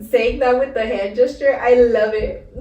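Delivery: loud, giggly delight at the gesture; real laughter breaking through; laughing. Real, not acted.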